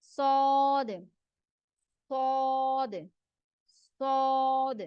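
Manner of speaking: teacher repeats heavy "सौद"; heavy letter demo. Shown as a demonstration. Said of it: "मोटा करके अदा करेंगे".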